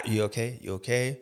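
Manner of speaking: soft, quiet worried asking; concerned soft check. Retold or imitated. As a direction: hush and check on the kid. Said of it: "I'm like".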